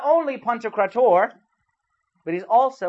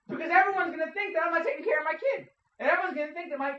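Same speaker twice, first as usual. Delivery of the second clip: high, pained parent's complaint, on the edge of tears; a parent hurt that people think he neglects his kid; upset parent; 8 semitones higher, 4 dB quieter, faster than usual. Retold or imitated. Parent frets to the congregation, near tears.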